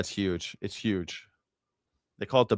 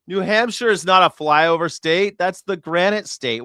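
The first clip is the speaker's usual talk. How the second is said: louder, higher insistence; emphatic; 6 semitones higher, 5 dB louder than usual. Real, not acted. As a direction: insist on it, raising the voice. Repeats the correction louder.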